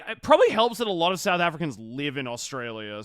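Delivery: ordinary delivery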